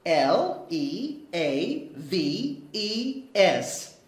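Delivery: slow, clear letter-by-letter spelling. Shown as a demonstration. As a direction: spell each letter slowly for kids. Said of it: "little green leaves".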